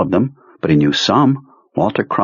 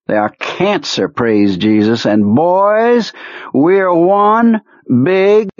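raving harangue, climbing higher towards the end; lunatic's voice; 5 semitones higher than usual. A narrator's reading. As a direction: rant like a lunatic rallying his boys, stretching the big words. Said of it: "the voice Henry thought of a lunatic".

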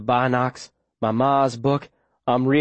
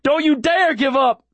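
high-pitched insistent yell; urgent shout; 14 semitones higher than usual. A narrator's reading. Boy to the wounded girl in his arms, shouting. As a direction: shout her out of giving up. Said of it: "I shout".

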